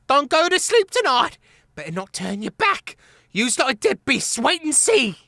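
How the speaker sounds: high, loud squeaky rat threats; calling out threats. A narrator's reading. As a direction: yell back menacingly. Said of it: "calling out threats".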